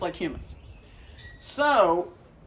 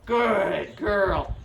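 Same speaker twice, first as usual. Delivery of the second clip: slow praise. Real, not acted. Praising dog after pause.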